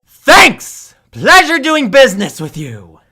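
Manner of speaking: high, loud overjoyed thanks; loud cheesy shopkeeper cheer; gleeful salesman. Acted. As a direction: oversell the gratitude. Salesman after the sale.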